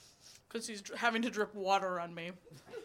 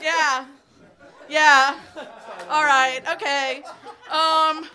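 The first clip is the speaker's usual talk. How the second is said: loud embarrassed laughter; laughing while regrouping; 4 semitones higher, 12 dB louder, slower than usual. Real, not acted. Regrouping after heckle, laughing.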